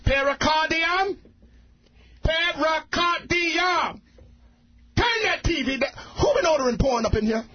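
high, slow called-out name; father calling his kid inside; drawn-out parent yell. Pretend. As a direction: holler the name across the house. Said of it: "he's yelling at his young children".

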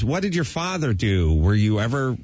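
normal talking voice

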